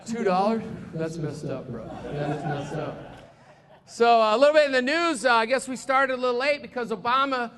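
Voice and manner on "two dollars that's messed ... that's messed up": low, soft put-on grumble; low-pitched mock complaint; Tom Sawaya. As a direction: drop the voice for a disgruntled cabbie. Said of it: "and there's Tom Sawaya".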